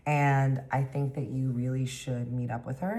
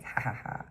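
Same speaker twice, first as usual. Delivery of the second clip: fake laugh; 4 dB quieter than usual.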